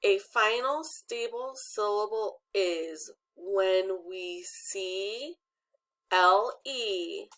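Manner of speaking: slow, measured read-aloud, word by word; deliberate pacing with pauses between phrases; reading along together. Shown as a demonstration. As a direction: lead a class reading slowly in unison. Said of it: "can you guys read this with me?".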